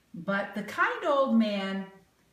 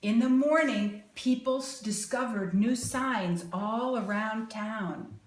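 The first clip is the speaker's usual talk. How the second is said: ordinary delivery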